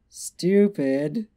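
loud, high acted moan, rising at the end; hammed-up moan of longing. A narrator's reading. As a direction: moan the name out with a lift. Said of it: "Twilight moaned".

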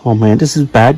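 ordinary talk

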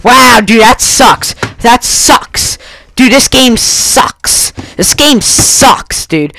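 exasperated gamer rant; frustrated outburst. Real, not acted. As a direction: complain bitterly, drawing out the key words. Rages at losing the game.

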